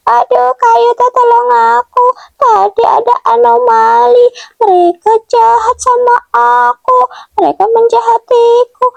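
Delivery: high-pitched tearful whine; acted crying. Mio tells a friend of being attacked, crying. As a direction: wail the plea like an upset character, pitched high. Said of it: "kenapa kamu menangis".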